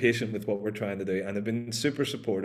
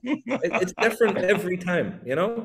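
higher, laughing through the joke; 9 semitones higher than usual. Real, not acted. Host joking to the guest about logistics mistakes, laughing. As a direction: chuckle the words out.

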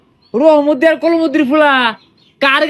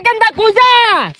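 high, long-drawn call; 7 semitones higher, slower than usual. Acted. Uncle hails Genda heading to college, calling out. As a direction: holler after someone, stretching the last word. Calls out after departing Genda.

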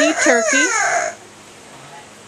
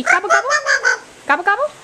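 turkey gobble; 5 semitones higher, much faster than usual. Pretend. Imitating a turkey.

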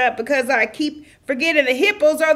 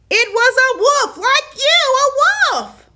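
high and loud, insisting; emphatic correction; 11 semitones higher, 3 dB louder than usual. Real, not acted. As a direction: hammer the point home at him. Exasperated correction again.

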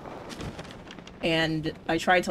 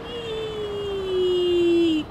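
high gleeful squeal while diving; gleeful whee; 11 semitones higher than usual. Real, not acted. Gamer diving down, a high gleeful whee. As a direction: squeal it out on the drop.

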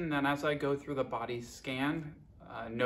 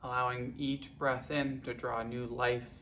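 guided meditation; 7 dB quieter than usual. Acted. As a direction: guide them unhurriedly, letting each phrase settle. Leads a guided body scan.

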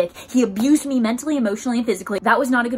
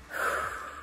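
slow, soft acted exhale; deep breath out; 10 dB quieter than usual. Holding dad's hand after the injury, acted deep exhale. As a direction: blow out a calming breath, as retold. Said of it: "gotta take deep breaths".

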